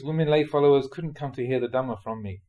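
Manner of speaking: usual speaking voice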